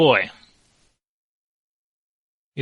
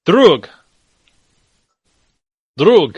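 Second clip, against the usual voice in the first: short, louder call with falling pitch; calling out a name; 4 semitones higher, 7 dB louder than usual. Acted. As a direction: call the name to get their attention. Calling to rouse another character.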